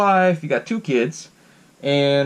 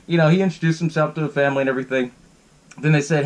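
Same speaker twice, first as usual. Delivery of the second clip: usual speaking voice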